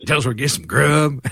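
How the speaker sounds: mock cowboy twang; fake cowboy accent. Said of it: "it's such a bad Western accent".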